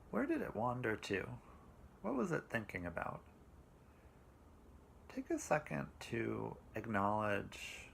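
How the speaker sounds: slow, calm meditation voice with long pauses; guided meditation. Acted.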